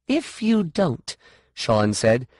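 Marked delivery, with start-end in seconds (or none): 0.1-1.1 high, slow, deliberate threat; pitched-up defiance; threatening. A narrator's reading. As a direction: warn her, stretching the words. Threat in story.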